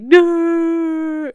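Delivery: long, loud high cry on one held note; mock bawling wail. Showing how hard crying freely is, exaggerated wail. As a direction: let out a big drawn-out pretend bawl. Acting out letting tears out.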